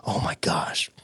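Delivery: breathy, stunned whisper after a gasp; shocked whisper. Retold or imitated. Told he got the show, shocked whisper. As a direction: whisper it in disbelief, retelling the shock. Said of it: "And I'm, like, shocked".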